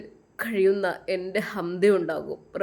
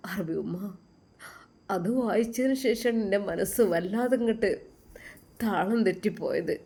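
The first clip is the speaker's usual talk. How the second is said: usual speaking voice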